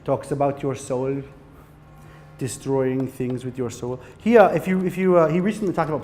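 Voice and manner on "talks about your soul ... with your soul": slow German filmmaker drawl, lower pitched; deliberate, heavy Herzog voice pondering the soul; Werner Herzog impression. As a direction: intone it slowly like a brooding German auteur. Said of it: "He talks like this".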